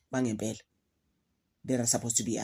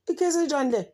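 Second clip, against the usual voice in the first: exasperated; 12 semitones higher, 4 dB louder, faster than usual. Retold or imitated. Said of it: "I'm just like".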